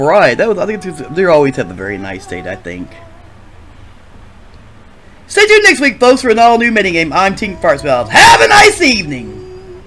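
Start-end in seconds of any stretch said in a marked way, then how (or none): 5.3-9.8 loud, high showman's outro; big TV host farewell; announcer sign-off. Acted. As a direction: belt out the show's sign-off. Show host's loud sign-off.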